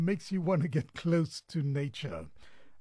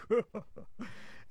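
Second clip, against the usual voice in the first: soft breathy laugh; 6 semitones higher, 6 dB quieter than usual. Real, not acted. Amused by own superstition story.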